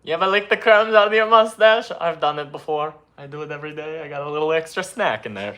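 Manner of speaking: goofy Italian editor bragging; Italian accent. Pretend. Italian editor Tony jokes about his mustache, Italian accent. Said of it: "My name is Tony".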